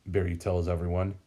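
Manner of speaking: ordinary delivery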